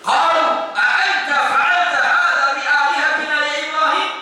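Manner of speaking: high-pitched accusing Quran quote; accusing recitation. Acted. Crowd confronting Ibrahim over their gods, accusing recitation.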